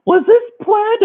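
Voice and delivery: high, slow, loud mock cry-laughing. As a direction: imitate a date cracking up. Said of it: "like, cry laughing".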